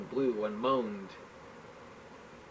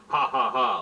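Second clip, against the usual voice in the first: spoken ha ha ha, louder; gloating laugh; mocking laugh; 7 dB louder than usual. Borsky gloating over the president, mocking laugh. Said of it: "laughed Borsky".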